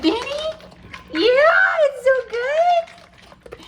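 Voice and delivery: very high, drawn-out pet-talk squeal. Real, not acted. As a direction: coo to the dog in a tiny high voice. Baby talk to her dog.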